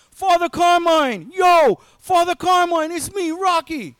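Rocky screaming for the priest; screaming up to rectory. Retold or imitated. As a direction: bellow up at the window, Philly style. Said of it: "he's screaming up to the rectory".

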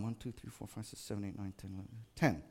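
soft counting under the breath in the checkout line. Retold or imitated. Shopper counting items in the express line, soft and under the breath. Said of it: "I'm going".

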